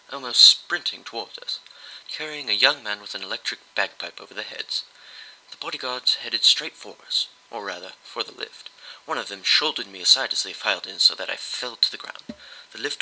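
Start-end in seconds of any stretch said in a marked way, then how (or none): none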